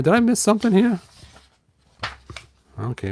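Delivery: ordinary delivery, nothing marked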